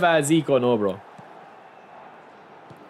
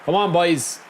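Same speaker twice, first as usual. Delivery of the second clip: ordinary talk, nothing marked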